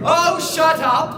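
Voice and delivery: loud, slow, hammed-up scold; mock-angry snap. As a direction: bark it at hecklers. Scolding the heckling audience.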